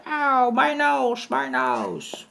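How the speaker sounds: high, slow wailing howl; howling in pain. A narrator's reading. Goat fleeing with a swollen nose, high slow howl of pain. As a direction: wail like a stung bully. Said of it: "fled howling into the night".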